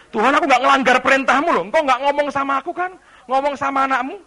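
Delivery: loud, fast, arguing back; indignant protest. Acted. Devil defending himself to God, indignant protest. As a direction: rattle off a loud self-defence.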